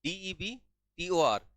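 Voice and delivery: slow letter-by-letter spelling. Shown as a demonstration. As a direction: spell डीईबीटीओआर one letter at a time, slowly. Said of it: "क्या होती है debtor की spelling?".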